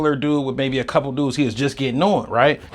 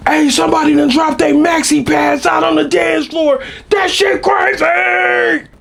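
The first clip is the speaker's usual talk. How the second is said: DJ shouting; 5 dB louder than usual. Retold or imitated. DJ calling out to the dance floor, loud high shout. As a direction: bellow it like a club DJ on the mic. DJ calls out over the music.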